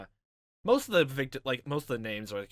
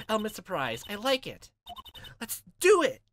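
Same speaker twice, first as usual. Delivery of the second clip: high excited voice, jumping louder at the end; eager sidekick; 9 semitones higher, 7 dB louder than usual. Acted. Sidekick urging lawyer to break in, high and excited. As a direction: build to a gleeful loud squeal.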